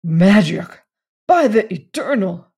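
loud, startled outburst; shocked exclamation. A narrator's reading. Randolph discovering the wax figure, loud shock. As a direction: burst out, aghast.

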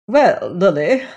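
grandly reluctant address; grudging concession. A narrator's reading. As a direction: condescend to use the servant's name. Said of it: "as if she made a distinct concession".

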